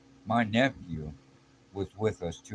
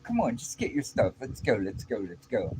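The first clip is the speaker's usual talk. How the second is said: hustle him out the door; controlling friend rushing him; bossy hurry-up; 6 semitones higher than usual. Retold or imitated. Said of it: "he goes".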